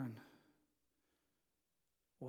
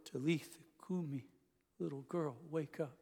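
much softer, gentle waking call; hushed, tender words to a sleeping girl; 8 dB quieter than usual. Acted. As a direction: coax a child awake, softly.

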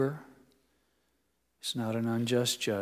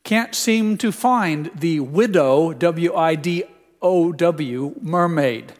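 loud, higher, slow machine voice; imitated TV assistant; 4 semitones higher, 8 dB louder, slower than usual. Apple TV fails to find the movie, imitated assistant voice. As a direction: play a puzzled voice assistant spelling out each letter. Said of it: "the Apple T V said".

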